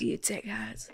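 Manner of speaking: sexy voice. Pretend. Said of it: "in a sexy voice".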